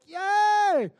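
very high, very loud giddy squeal; drugged patient cheering at the lobby; loopy cheer. Retold or imitated. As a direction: whoop like you're high on gas. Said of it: "I was just like".

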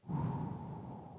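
long, soft breath out; focused exhale. Retold or imitated. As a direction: breathe out slowly like a Karate Kid zeroing in. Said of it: "trying to be the Karate Kid, just zeroing in".

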